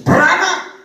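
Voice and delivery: loud, high command; commanding faith declaration. Retold or imitated. Man orders his motorbike to multiply, loud high command. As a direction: cry it out as a command. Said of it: "dalam nama Yesus".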